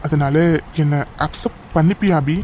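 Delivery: ordinary talk, nothing marked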